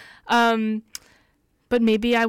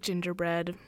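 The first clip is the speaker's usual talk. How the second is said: ordinary talk, nothing marked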